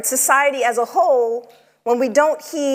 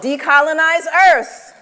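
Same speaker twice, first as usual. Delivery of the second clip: louder, high, with a laugh; laughing call-out; 5 semitones higher, 5 dB louder than usual. Acted. Prompting audience call and response.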